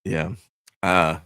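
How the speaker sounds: low, downcast response; deflated. Real, not acted. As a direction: let the voice sink, dejected. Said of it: "your voice just went down".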